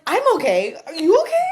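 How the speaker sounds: high, loud frantic question; mock panicked phone check. Retold frantic phone exchange.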